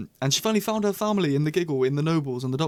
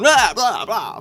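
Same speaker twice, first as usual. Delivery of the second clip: high, loud mocking blah blah; mock chatter; 7 semitones higher, 6 dB louder, much slower than usual. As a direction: rattle it off like endless prattle.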